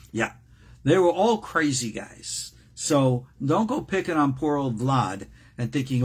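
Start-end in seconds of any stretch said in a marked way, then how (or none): none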